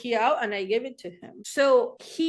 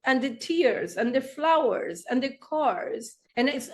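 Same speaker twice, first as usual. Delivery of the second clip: ordinary talk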